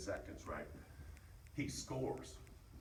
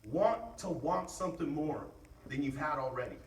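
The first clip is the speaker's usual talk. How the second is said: pep talk; 9 semitones higher, 7 dB louder than usual. Real, not acted. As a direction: push them to want it. Pep talk calming then rising.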